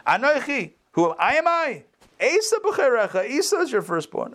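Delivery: "Anoichi" pitched high and set apart from "Esau"; split into separate statements. Shown as a demonstration. Teacher quoting a commentary's reading, "Anoichi" split from "Esau". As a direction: lift "Am I" very high, then start "Esau" afresh. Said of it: "a period here".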